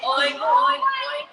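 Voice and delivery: high, loud, slow oinking; pig oinks. Acted. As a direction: oink like a cartoon pig. Pig sound for kids.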